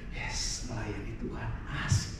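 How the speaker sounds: hushed, breath-only cheer; soft, low pretend glee; quiet delight. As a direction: whisper an acted little celebration to yourself. Acts contented volunteer strapped in.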